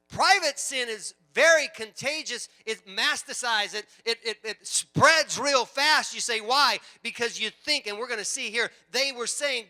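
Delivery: loud, high, emphatic preacher's delivery; preaching at full force. Real, not acted. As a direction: drive it home to the whole congregation.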